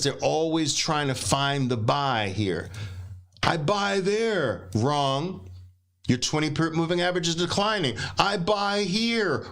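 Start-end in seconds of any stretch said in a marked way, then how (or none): 3.4-4.7 slow, higher mock voice of a clueless trader; naive trader. 8.2-9.5 slow, higher mock voice of a clueless trader; naive trader.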